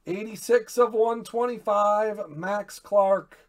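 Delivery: real high, very loud celebration; excited shouting. Real, not acted. Card breaker celebrating Doug's Max Clark hit, excited shouting. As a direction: shout the hit out, stretching key words.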